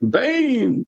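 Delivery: high, muffled masked villain shout; muffled Bane voice. Retold or imitated. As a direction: bark the name through a covered mouth. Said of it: "he sounds a little more muffled".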